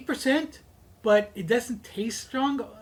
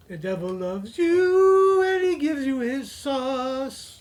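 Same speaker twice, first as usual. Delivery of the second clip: devil voice; 4 semitones higher, 4 dB louder, much slower than usual. Pretend. Devil sauce sales pitch.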